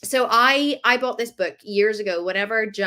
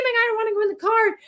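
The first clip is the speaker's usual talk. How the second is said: high, mock toddler yell; screaming toddler; 13 semitones higher than usual. Toddler protesting being put in the car, mock yell. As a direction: imitate a kid refusing the car. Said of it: "he's screaming".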